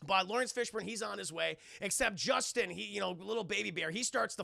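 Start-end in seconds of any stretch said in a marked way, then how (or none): none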